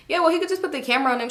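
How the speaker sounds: fast, high, pushy; rushed. Retold or imitated. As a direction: rattle it off quickly. Rushed suggestion.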